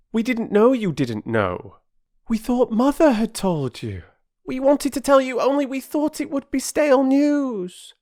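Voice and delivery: put-on very high, loud eager children; all talking at once to explain; children pleading together. The three children to Perks, eager and pleading.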